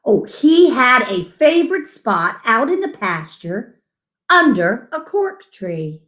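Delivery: unhurried picture-book read-aloud; reading to children. Acted. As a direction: read it to little ones, savouring each line.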